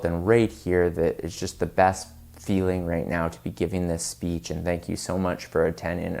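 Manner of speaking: low, droning monotone speech; boring monotone. Shown as a demonstration. Dull speaker claiming excitement about giving the speech, low monotone. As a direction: drone through it without any life. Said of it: "that monotone sound".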